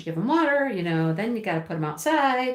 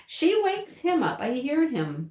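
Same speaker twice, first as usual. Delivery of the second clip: ordinary talk, nothing marked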